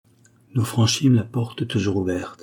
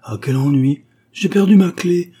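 louder, much higher-pitched dismay; vexed exclamation; 8 semitones higher, 7 dB louder than usual. A narrator's reading. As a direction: cry out over a small mishap. Heroine finds she lost her key.

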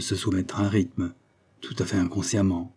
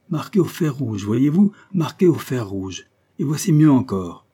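bitter outburst; 5 semitones higher, 5 dB louder than usual. Acted. Scrope showing his brand.

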